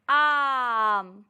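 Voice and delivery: आम with the आ drawn out long. Shown as a demonstration. Teacher to children, demo with long aa. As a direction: stretch the आ in आम for children. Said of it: "अब इसको एक साथ बोलेंगे बच्चों".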